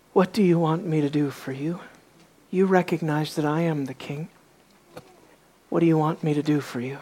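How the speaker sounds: low, hushed, measured delivery; soft-spoken Jesus. Acted. Jesus answers the blind man who came to him, softly. Reading out Jesus' gentle reply.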